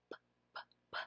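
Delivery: voiceless breathy "P, p, p"; whispered P sound. Shown as a demonstration. Trainer models the bare "p" sound as a whisper. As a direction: puff out "p" with no voice. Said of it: "say the sound".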